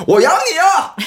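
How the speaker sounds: high, loud yell across a distance; desperate far-off call. Retold or imitated. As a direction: cry it out desperately, as if from afar. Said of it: "주저주저 하다가 항구 쪽에서 멀리서".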